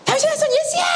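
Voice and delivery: very high, straining acted scream; piercingly high yell, fully acted; realistic beggar's shout. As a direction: scream out for help like a desperate blind beggar. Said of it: "좀 더 리얼하게 좀 하고 싶은데".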